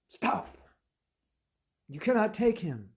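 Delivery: acted stern intervention; commanding halt. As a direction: forbid them with authority. Messengers halt the soul's capture.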